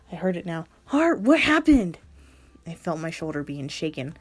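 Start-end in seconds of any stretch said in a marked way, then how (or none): 0.9-2.0 high, loud, slowed worried cry; shocked friend demanding answers; panicked concern; alarmed. Acted. Friend finds her sobbing.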